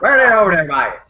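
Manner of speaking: exaggerated Newfoundland accent; Newfie accent. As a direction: call out like a Newfoundlander. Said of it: "imagine a Newfie trying to use voice search".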